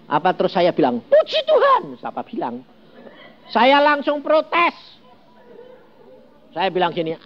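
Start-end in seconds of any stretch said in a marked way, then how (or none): 1.1-2.0 high, bright "Puji Tuhan"; elated praise. Retold or imitated. Preacher retells his happy reply to God, high and exultant. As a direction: burst out in delighted praise. Joyful response retold.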